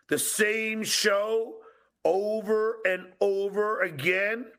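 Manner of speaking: high, slow mock retort; mocking whine. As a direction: drag it out mockingly.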